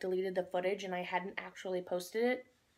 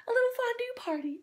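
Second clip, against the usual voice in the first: excited squeal; 13 semitones higher, 4 dB louder than usual. Real, not acted. Excited about fondue.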